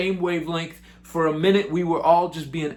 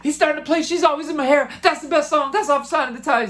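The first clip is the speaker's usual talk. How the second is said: much higher, giddy fanboy squeal; freaking out; 12 semitones higher than usual. Retold or imitated. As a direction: gush like a starstruck fan. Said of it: "I start freaking out".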